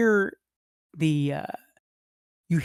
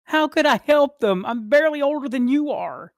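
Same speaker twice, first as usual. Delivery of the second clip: high, heated protest; upset defence; 10 semitones higher, 4 dB louder than usual. Acted. Brother defends not helping the parents, high upset protest.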